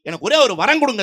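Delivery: loud acted plea; pleading devotee. Man asking a holy man for a boon, loud plea. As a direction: beg the holy man earnestly, hammed-up.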